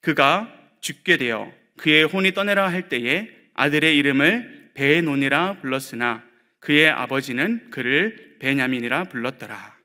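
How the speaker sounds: usual speaking voice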